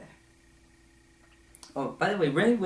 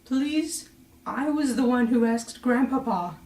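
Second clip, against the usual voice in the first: much higher, pleading put-on voice; mock pleading Jennifer Connelly; 12 semitones higher than usual. Daughter begging grandfather, hammed-up high pleading. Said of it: "when she's saying".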